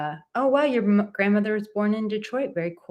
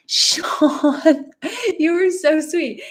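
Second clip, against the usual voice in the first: delighted gasp; 9 semitones higher, 5 dB louder, much slower than usual. Real, not acted. Touched by viewer's super chat.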